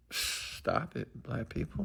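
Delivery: whispered stop it. Real, not acted. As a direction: hush it like a secret plea. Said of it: "whispered plea to audience".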